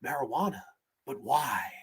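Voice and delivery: low, soft deadpan line; quiet, low movie-doctor voice; imitated doctor. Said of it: "as this doctor".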